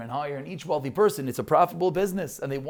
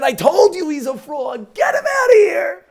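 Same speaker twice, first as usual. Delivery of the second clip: laughing mock shout, much higher and louder; hammed-up angry crowd yelling, pitched way up; crowd screaming; 13 dB louder than usual. Bidders yell at the poor man, laughing mock shout. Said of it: "they start screaming again".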